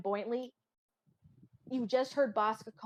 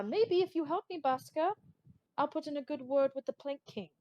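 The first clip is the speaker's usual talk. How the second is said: higher-pitched bargaining voice; wheedling bird pirate; 5 semitones higher than usual. Acted. Bird pirate offers Baska a good word, high-pitched wheedling. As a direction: pitch it up to coax an old crewmate.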